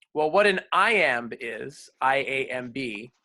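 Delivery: ordinary talk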